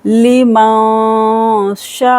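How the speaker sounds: लिमन शाअ with शा held four to five seconds; long शा. Shown as a demonstration. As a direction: stretch the शा in शाअ. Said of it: "शा को खींचेंगे". (शाअ को चार-पाँच सेकंड खींचें)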